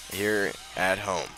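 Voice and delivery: usual speaking voice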